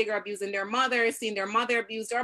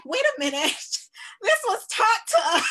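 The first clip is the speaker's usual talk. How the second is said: high, loud imitated surprise with a laugh breaking in; surprised realization; 12 semitones higher, 6 dB louder than usual. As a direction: replay the dawning shock of a student, much higher and louder. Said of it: "Mackenzie was like".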